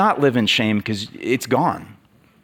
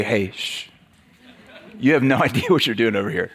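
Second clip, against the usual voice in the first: mock dismissive hush; 4 semitones lower, faster than usual. Imagined guide hushing the climber.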